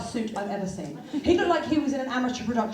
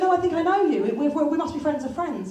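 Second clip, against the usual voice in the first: shop lady; 5 semitones higher, 3 dB louder than usual. Retold or imitated. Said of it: "She went".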